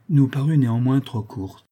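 plain reading voice

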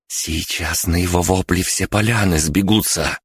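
usual speaking voice

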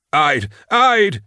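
high panicked cry, rising sharply; screaming. A narrator's reading. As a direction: shriek for help, terrified. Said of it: "he screamed".

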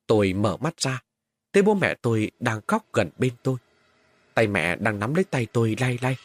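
plain reading voice

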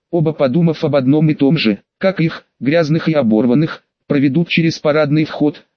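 ordinary delivery

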